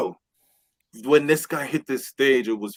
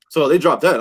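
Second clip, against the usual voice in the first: ordinary delivery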